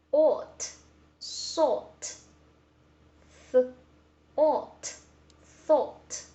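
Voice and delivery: ought, bought, thought said with a rounded British aw, /ɔt/, high and loud; British ought sound. Shown as a demonstration. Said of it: "they say this word as ought".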